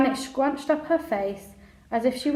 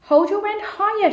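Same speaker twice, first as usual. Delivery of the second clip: higher, louder shout; 11 semitones higher, 6 dB louder than usual. A narrator's reading. Janet ordering Jack while lifting the crate, shouted. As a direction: call out an order sharply. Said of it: "shouted Janet".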